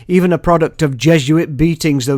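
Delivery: ordinary talk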